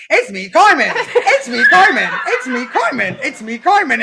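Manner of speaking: high, loud mimicked text alert; imitated phone notification. Mimicking the phone going off beside a sleeping partner, insistent alert voice. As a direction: chirp it insistently, like a repeating notification. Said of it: "and he goes".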